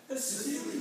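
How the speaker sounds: high put-on cartoon accent; Stewie accent. Shown as a demonstration. Coach imitating the cartoon baby for choir, Stewie accent. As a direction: pitch it way up in the cartoon character's accent. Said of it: "let's say it with his accent".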